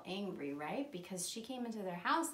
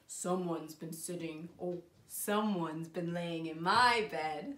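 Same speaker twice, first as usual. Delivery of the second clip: low, slow bear voice; great big bear; 6 semitones lower, slower than usual. Acted. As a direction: rumble it slowly like a huge bear. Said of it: "or the great big bear".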